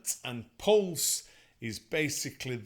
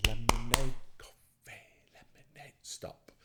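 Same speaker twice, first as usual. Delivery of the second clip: put-on children's part, whispered; whispered echo; 3 semitones lower, 4 dB quieter than usual. Shown as a demonstration. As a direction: whisper the copy back softly. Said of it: "And just copy me".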